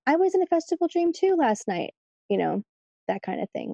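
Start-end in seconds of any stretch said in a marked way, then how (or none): 0.1-1.9 high-pitched excited reply; mock excitement. Housemate replying about a shared dream, mock excited. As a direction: chime in eagerly, pitch jumping up.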